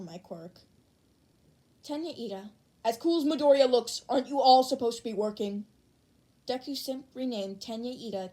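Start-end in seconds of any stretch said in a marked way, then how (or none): 2.8-5.6 louder scolding voice; stern class president. Acted. Ida scolding classmates for slacking, loud stern voice. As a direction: scold the class to get back to work.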